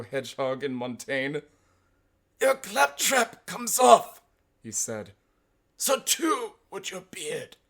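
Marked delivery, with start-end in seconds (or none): 2.4-4.2 very high, loud, slow put-on voice; mock-outraged academic; indignant professor. Retold insult from rival professor. 5.8-7.6 high, slow retort; drawn-out high academic comeback; mock professor.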